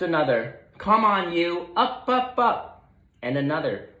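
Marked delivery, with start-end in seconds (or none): 0.8-2.9 high, loud coaxing call; bossy wake-up call; rousing leaves. Acted. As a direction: urge the sleepy leaf up.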